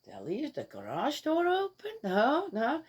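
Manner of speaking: high, wondering voice, then quick no-no; lightly voiced startled homeowner; mock startled self. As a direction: play the surprised neighbour with the garage. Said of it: "I'm going".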